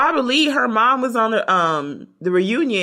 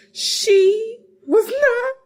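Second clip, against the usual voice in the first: laughing; 12 semitones higher, much slower than usual. Retold or imitated. Laughing retelling of girl.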